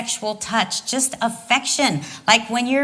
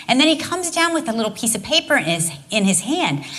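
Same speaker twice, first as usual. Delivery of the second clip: normal talking voice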